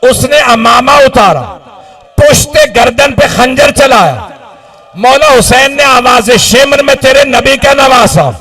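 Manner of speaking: keening preacher's lament; lamenting sermon at full force. Acted. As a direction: cry the tragedy out to the mourners.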